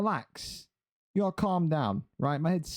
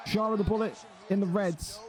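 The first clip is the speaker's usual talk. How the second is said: shouted fighter introduction; ring announcer call; 3 semitones higher than usual. Acted.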